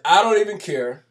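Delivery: loud, brash mock of the guy's shrug-off; mocking loud quote. Replaying the guy's defiance to viewers, loud mocking imitation. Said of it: "He says".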